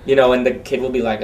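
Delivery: usual speaking voice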